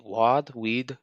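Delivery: lightly mock-Arabic pronunciation. Shown as a demonstration.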